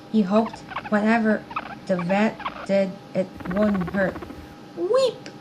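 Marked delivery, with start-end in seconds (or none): 4.7-5.3 high guinea pig squeak; a quick little high squeak. A narrator's reading. Bertie says goodbye to Jenny and Amy, high squeak. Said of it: "squeaked Bertie".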